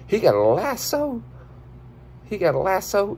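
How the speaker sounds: high amazed exclaim; excited. Acted. Spotting a lasso in the video, high amazed exclaim. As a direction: marvel at the lasso.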